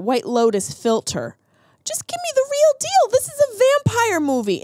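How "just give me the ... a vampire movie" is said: very high-pitched exasperated plea. Real, not acted. Reviewer ranting about a filtered vampire movie, exasperated. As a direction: let the voice leap way up in protest.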